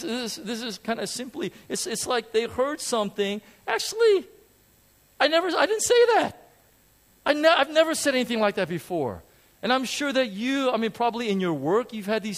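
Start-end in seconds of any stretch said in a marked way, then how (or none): 3.7-9.2 high, loud, shocked protest; indignant denial. Retold or imitated. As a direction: protest as if wrongly accused.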